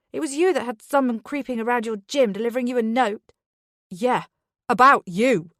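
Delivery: loud, fast furious accusation; angry accusation. Acted. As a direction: jab each word at him. Drunken quarrel with Charlie.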